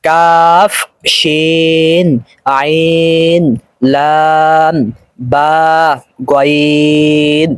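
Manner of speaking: "কাফ, শিন, আইন" and the rest held long on a level tone; drawn-out letter recitation. Shown as a demonstration. Teacher reading a line for learners, "কাফ" to "গইন" drawn out. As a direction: slowly stretch each name through "গইন", pausing between. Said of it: "টান সহকারে আয়ত্ত করতে হবে".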